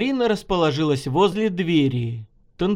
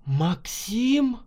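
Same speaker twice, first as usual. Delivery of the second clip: high, questioning gasp of a name; surprised woman's higher voice; startled; 6 semitones higher than usual. A narrator's reading. Woman startled to see him.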